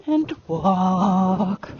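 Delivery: WALK very long and low; downward transition command. Shown as a demonstration. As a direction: drop the voice and stretch "walk". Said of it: "my voice goes down".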